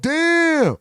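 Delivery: high, loud disbelieving damn; a high, loud, exasperated damn; damn exclaimed. Real, not acted. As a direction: let out a stunned damn. Exclaims at people.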